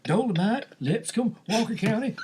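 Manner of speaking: put-on high old-timer listing hometowns; proud old man rattling off places. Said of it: "that's why I make the joke of".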